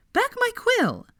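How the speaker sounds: high, loud insistence; demanding quill back. Acted. Hermione demanding her quill from Harry, high loud snap.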